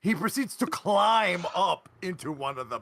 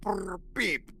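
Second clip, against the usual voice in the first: angry robot beeping; 3 semitones lower, 6 dB quieter than usual. Pretend. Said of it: "Taco is just responding in kind".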